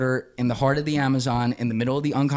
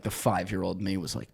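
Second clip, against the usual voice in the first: usual speaking voice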